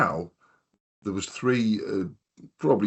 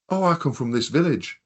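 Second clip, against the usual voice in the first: higher-pitched Durham lad impression; imitated Durham accent; 7 semitones higher than usual. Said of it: "I knew he had a Durham accent".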